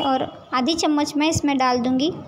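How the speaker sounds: ordinary talk, nothing marked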